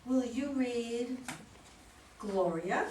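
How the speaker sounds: ordinary talk, nothing marked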